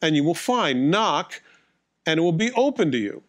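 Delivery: preacher reading scripture aloud. Acted. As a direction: read the verses with weight.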